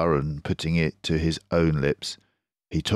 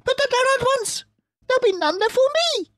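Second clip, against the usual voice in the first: very high, loud squealing rat; shrieking Rat; 4 dB louder, much faster than usual. Acted. Rat shrieks at fox and badger for poaching. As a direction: shriek it in outrage. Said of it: "shrieked Rat".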